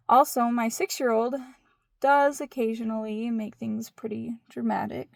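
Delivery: ordinary talk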